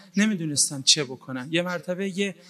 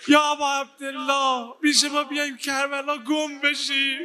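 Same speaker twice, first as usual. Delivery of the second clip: higher pleading lament prayer; tearful supplication; 10 semitones higher than usual. Acted. Preacher begging Aba Abdillah for healing, pleading lament. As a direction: plead to the Imam as if weeping.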